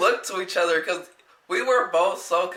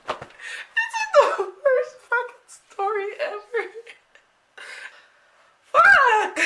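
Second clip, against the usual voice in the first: very high squeaky cartoon voice, slow; squeaky high-pitched wail that ends in a loud yelp; Mickey Mouse squeak; 4 dB louder, slower than usual. Real, not acted. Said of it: "That sounds like fucking Mickey Mouse".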